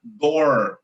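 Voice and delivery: "door" with a retroflex R, held long; "door" ending in a long American-style R; paulista R. Shown as a demonstration. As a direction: draw out "door" on a curled-tongue São Paulo country R. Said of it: "o R é o R do paulista".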